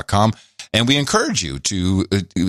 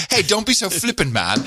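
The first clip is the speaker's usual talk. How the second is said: mock scold; 8 semitones higher than usual. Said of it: "I'm gonna say that to everybody now".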